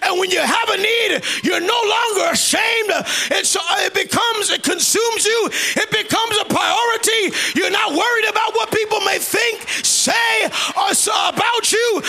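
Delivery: high, driving pulpit delivery; full-force preaching. Acted. Preacher telling church that need overrides shame, full-force preaching. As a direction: build relentlessly, phrase upon phrase.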